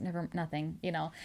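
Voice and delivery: soft, low take-it-back; dismissive retreat. Acted. Man backing off his what-if to her, softly dismissive. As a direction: drop the voice and back off.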